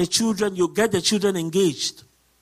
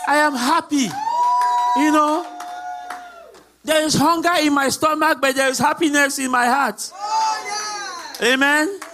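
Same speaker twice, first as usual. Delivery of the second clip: joyful proclamation; 5 semitones higher than usual. Real, not acted. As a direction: cry it out to the congregation. Preacher proclaims her joy.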